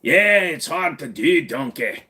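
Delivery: put-on slow Scottish-accented ogre voice; exaggerated ogre brogue, dropping at the end; Shrek. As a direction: drag it out like the cartoon ogre. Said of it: "A little bit like Shrek".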